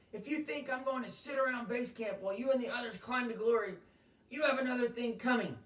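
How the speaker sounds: high, loud, indignant rant; angry client shouting at his guide. Acted. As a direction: keep yelling, outraged at being left behind. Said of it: "the man shouted".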